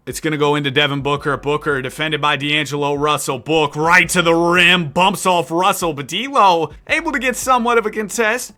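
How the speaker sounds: live sports commentary, rising with the action; energetic announcer call; play-by-play. Acted. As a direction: call the game like a broadcaster. Live play-by-play of game sim.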